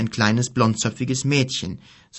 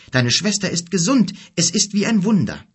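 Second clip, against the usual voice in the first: higher, louder, radiant happiness; glowing with joy; 7 semitones higher, 4 dB louder than usual. A narrator's reading. Mother telling Kim his sister is healed, overjoyed. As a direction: let the joy burst through. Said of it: "sagte sie glücklich".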